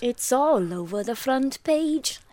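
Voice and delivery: put-on lightly done Welsh accent, slowed right down. Quoting a Catatonia song to her co-host in a slow, light Welsh accent. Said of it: "You're really good at accents".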